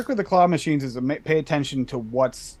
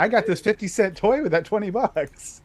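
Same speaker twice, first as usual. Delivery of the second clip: put-on proud kid voice; 6 semitones higher than usual. Kid showing off toy.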